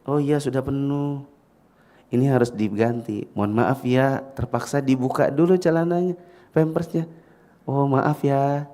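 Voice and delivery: put-on soft, tender talk to a baby; hushed, lilting, polite baby talk; gentle caregiver apology. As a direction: soothe an infant while apologising. Caregiver apologising while changing diaper.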